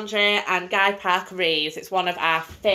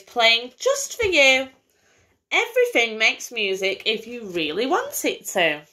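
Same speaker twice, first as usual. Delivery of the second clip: lively bedtime story reading; singsong picture-book voice for a little girl; reading to a child; 3 semitones higher than usual. Acted. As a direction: read it like a storybook to a small child. Mother reads picture book aloud.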